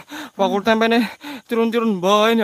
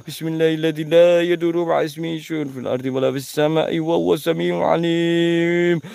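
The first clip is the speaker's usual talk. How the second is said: low murmured Arabic protective prayer; hushed prayer against a ghost; murmured prayer recitation; 6 semitones lower than usual. Real, not acted.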